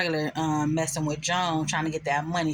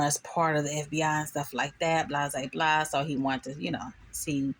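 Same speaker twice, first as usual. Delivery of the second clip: ordinary talk, nothing marked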